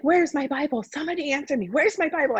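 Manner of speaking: retold furious plea; angry demand. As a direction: demand an answer through tears. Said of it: "I'm mad. I'm crying".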